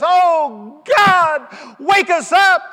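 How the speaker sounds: high, loud, slow crying plea; tearful cry. Retold or imitated. Leader weeps and cries to God, loud high plea. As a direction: cry it out to heaven. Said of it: "tears streaming down his cheek".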